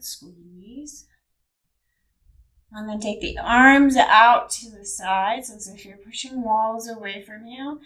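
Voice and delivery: calm yoga instructor talking through movements; guided meditation. Acted. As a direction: guide the body through each stretch calmly.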